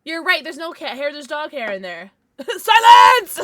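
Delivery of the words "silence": laughing mock shout, very loud; loud, high comic order through laughter; mock command. Real, not acted. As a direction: bark it like a playful tyrant. Laughing, telling chat to hush.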